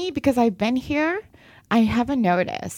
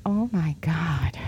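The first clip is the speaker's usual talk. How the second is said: slow hushed whisper of amazement; whispered awe; 6 semitones lower, 4 dB quieter, slower than usual. Retold or imitated. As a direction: breathe it out slowly in disbelief. Retold stunned reaction on arrival.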